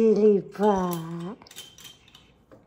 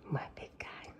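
whisper; 15 dB quieter than usual. Real, not acted. Whispered pet talk.

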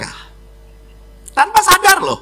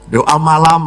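ordinary delivery

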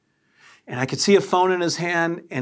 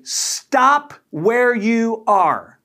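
slow, loud, high command to halt; firm command; 7 semitones higher, 5 dB louder, much slower than usual. Retold or imitated. To the approaching stranger, a slow loud command. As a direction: stop him in his tracks, every word spaced.

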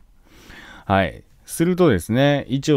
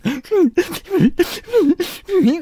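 mock wail; 4 dB louder than usual. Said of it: "おいおいと泣いてって感じで".

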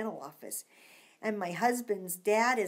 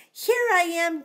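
excited; 6 semitones higher, 6 dB louder than usual. Acted. Dinosaur pops out.

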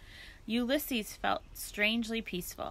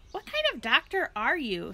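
put-on higher, louder exasperated girl; exasperated Flora; 5 semitones higher, 6 dB louder than usual. A narrator's reading. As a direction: throw up your hands in disbelief. Flora indignant.

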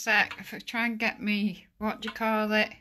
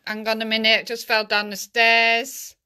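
shaken and loud; 8 dB louder than usual. Real, not acted. Just fell down stairs.

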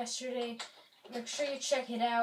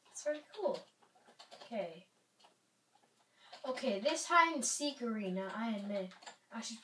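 normal talking voice

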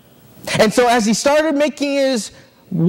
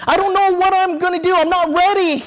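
put-on high-pitched, urgent plea of a frightened boy; acted child begging not to be left, without real sobs; tearful pleading; 8 semitones higher than usual. Son begs his father not to leave him, tearful pleading. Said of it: "his son started crying".

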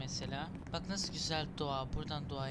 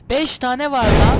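loud, slowed, higher cry of alarm; alarmed shout; 8 semitones higher, 21 dB louder, slower than usual. Real, not acted. Gamer spotting five monsters, shouting in alarm. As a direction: yell it as the monsters appear.

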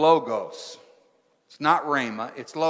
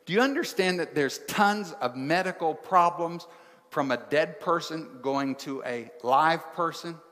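normal talking voice

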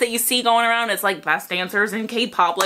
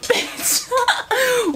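much higher, laughing exclamation; laughing squeal; 13 semitones higher than usual. Real, not acted. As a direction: cry out through a laugh. Overwhelmed by performance.

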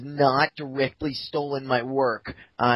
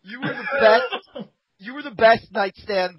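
laughing; 8 semitones higher than usual. Real, not acted. Laughing praise.

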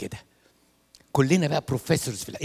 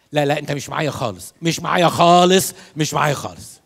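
louder, slowed teasing rebuke; mock rebuke; 8 dB louder than usual. Real, not acted. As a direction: tell them off playfully. Rebuking inattentive congregation. (لوم مازح للحاضرين)

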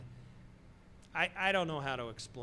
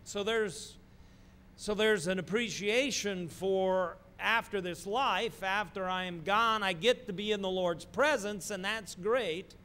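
soaring preacher's voice, higher and louder; 7 semitones higher, 8 dB louder than usual. Acted. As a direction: build to a joyful climax. Preaching builds to climax.

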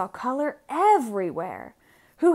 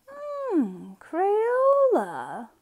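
a lightly voiced Edwin, thoughtful hum pitched higher, then savoring the name; Edwin pondering; 9 semitones higher, much slower than usual. Edwin hears his wife's idea, a lightly voiced thoughtful hum. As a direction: muse over it, then let the name win you over.

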